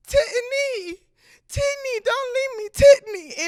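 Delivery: put-on very high, loud toddler voice; little brother calling out. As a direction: imitate a small boy crying out after his sister. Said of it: "and he's like".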